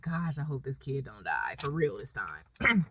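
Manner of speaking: very low, slow and barely voiced; quiet, anxious aside; hushed worry. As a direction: drop to a worried murmur, drawing it out. Worried aside about the child.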